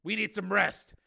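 high, loud, urgent character voice; Steele. Acted. Steele urging his tired companion to find shelter, loud and insistent.

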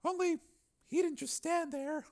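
a wounded woman remembering; Donna. Acted. Donna recalling the attack to Steele, bitter.

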